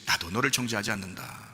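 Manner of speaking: soft, low, tender pardon; hushed, kindly admonition; 예수님, very gentle. Acted. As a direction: forgive quietly, slowing on the last words. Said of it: "참 부드럽게 얘기했지만".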